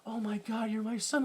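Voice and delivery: hushed, mock-touched quote; retold tender reveal. Father to son over soft piano, softly moved. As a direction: softly imitate a stunned father.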